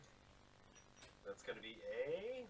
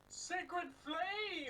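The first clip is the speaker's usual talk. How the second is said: high, drawn-out cry; dramatic spell call. Acted. Player casting a cantrip at the enemy, dramatic spell call.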